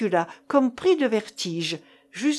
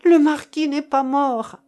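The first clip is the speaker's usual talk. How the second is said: loud, high, astounded exclamation; crying out; 5 semitones higher, 7 dB louder than usual. A narrator's reading. Marcof reveals the empty coffin to Keinec, crying out. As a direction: shout the astonishing news. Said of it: "s'écria-t-il".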